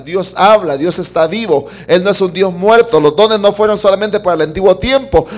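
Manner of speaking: loud, fervent preaching; preaching at full force. Acted. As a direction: proclaim it to the whole church. Preaching climax about living God. (predicación con fuerza)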